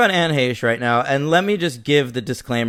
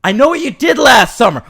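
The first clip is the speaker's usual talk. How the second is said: loud, quick, high-pitched threat; threatening note shouted out; 9 semitones higher, 8 dB louder, faster than usual. Acted. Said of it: "it sounds threatening".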